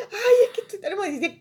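high, loud, fast emotional gush; imitated near-tears emotion. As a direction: mimic someone almost crying with excitement. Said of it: "como que casi lloraba".

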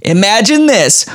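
put-on high-pitched, animated New Jersey accent. Reading out Sylvia's pro-combat juggling essay, put-on Jersey accent. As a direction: read it as a hammy Jersey tough talker. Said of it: "how do you do a Jersey accent?".

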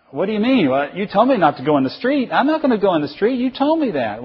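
high-pitched, insistent mock protest; hammed-up indignant objection; indignant son. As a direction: argue like an overgrown child. Acted argument of parent and son.

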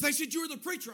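high, urgent retold plea; frantic. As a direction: imitate a man in a panic. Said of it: "He comes up to me frantic".

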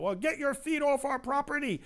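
high, mocking shout of the clinic staff; retold shout. Clinic staff shouting at praying protesters, high mock shout. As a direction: fling it out like the annoyed staff. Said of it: "the people in the abortion facility shouted out".

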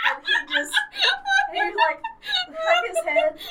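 very high, loud, helpless laughter; really cracking up. Real, not acted. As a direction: squeak the words out through laughter. Cracking up while telling own story.

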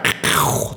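throaty whoosh of something fired skyward; mouth-made shot into the sky; imitated launch noise. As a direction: make a rasping launch sound with the mouth.

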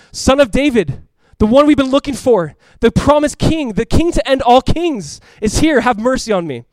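loud, high, building proclamation that eases at the end; impassioned cry. Acted. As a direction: proclaim it as a beggar crying out. Acting out the beggar's cry.

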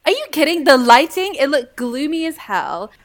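loud, high, incredulous protest; loud disbelief. Real, not acted. Protesting to friends that the film looked gloomy, loudly. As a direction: blurt it out in disbelief.

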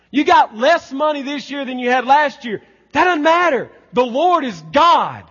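high, loud pulpit cry; full-force preaching. Real, not acted. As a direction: proclaim it at the top of a preaching voice.

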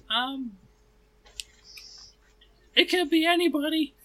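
high, quick nervous halfling voice; Tulio voice. Acted. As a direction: squeak it out like a jittery little halfling. GM voices Tulio asking help.

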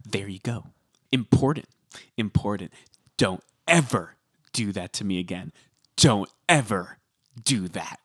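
low, measured mock threat; Dorinda, angry mafioso. Imitating angry Dorinda warning someone, mafioso-style menace. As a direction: imitate a mob boss warning, pausing between phrases. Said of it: "when she's angry being mafioso-esque".

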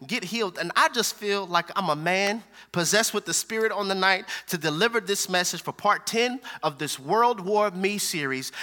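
ordinary talk

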